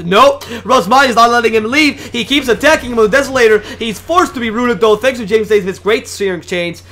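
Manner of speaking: loud, high esports shoutcast; excited play-by-play. Real, not acted. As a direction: call the team fight at full intensity. Live play-by-play of the chase.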